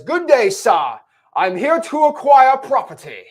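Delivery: high, slow, pompous upper-class voice; posh rich landlord. Retold or imitated. Imitating the landlord people picture, pompous and slow. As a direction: put on a grand old-money gentleman. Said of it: "And that's how he talks".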